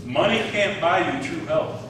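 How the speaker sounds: normal talking voice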